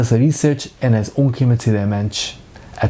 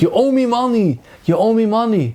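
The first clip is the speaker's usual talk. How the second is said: high mock yell of a debtor's demand; retold shout; 9 semitones higher, much slower than usual. Husband retelling his dream to his wife, mock yell. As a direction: repeat the creditor's cry, pitched high. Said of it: "און ער שרייט מיך".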